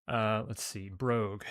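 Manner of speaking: normal talking voice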